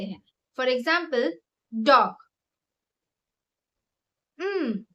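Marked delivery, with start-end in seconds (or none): 4.4-4.8 one short falling hum; single hum with lips closed; closed-mouth hum. Shown as a demonstration. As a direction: hum dog as a single syllable. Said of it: "एक ही बार हम किया".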